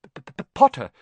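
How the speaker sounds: high, loud burst after a stuck stammer. A narrator's reading. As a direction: stammer hard on the first sound. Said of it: "stammered Professor Quirrell".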